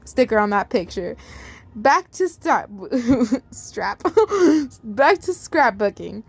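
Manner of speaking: ordinary delivery, nothing marked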